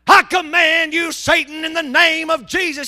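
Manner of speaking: full-blast, high exorcism shout; reenacted shouted command. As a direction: slowly thunder it out at the devil. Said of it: "I did lower my voice".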